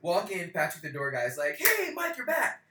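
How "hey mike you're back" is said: much higher, louder welcome; bright mock barman hello; cheery greeting. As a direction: greet a regular like an old friend. Door guy greets him.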